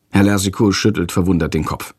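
usual speaking voice

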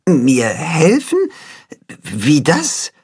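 puzzled, slow chicken question; confused. A narrator's reading. Chicken puzzled by the cat's story. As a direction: sound lost and confused. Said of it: "ziemlich verwirrt".

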